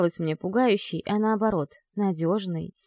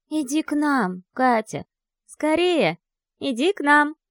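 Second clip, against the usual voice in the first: put-on eerie ghost child; 6 semitones higher, 4 dB louder than usual. A narrator's reading. Ghost child voices luring her.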